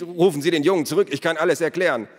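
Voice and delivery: fast, high, loud pleading; urgent plea. A narrator's reading. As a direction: beg in a rush to stop them. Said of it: "rief der Schmied". (hastig und flehend gerufen)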